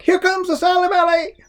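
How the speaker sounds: high loud alarm; yelling. A narrator's reading. Jack warning Grandma of the monster, a high loud yell. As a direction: cry it out high in panic. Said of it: "yelled Jack".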